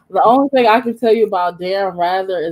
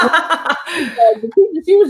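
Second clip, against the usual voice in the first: laughing; 8 semitones higher, slower than usual. Real, not acted. Laughing while telling story.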